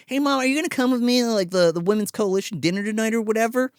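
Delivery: put-on fast, high girlish voice; high quick teen question; high daughter voice. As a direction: rattle it off like a teen asking mom. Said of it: "she's like".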